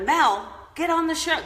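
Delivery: rally her onto the show; playful call-out. Pretend. Vlogger calls out to Mel Robbins, playfully loud.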